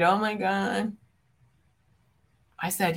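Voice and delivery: ordinary talk, nothing marked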